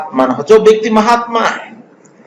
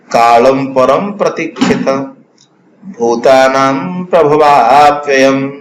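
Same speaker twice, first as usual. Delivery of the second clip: low, slow recitation of a Sanskrit verse; 5 semitones lower than usual. Acted. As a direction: recite the line low and unhurried. (नीचे स्वर में धीमा श्लोक पाठ)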